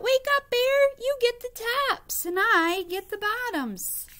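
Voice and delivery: high, loud call. Acted. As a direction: shout to wake him. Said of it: "Hare called out".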